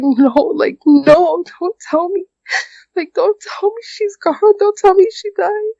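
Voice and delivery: beg, as if she is falling apart; breaking down. Real, not acted. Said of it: "that's when I just broke down".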